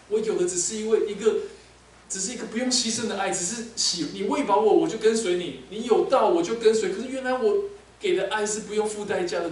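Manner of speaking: loud, rising anguished confession; anguished outcry. Acted. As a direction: pour it out loudly in pain. Peter's anguished outpouring. (彼得痛苦地高声倾诉)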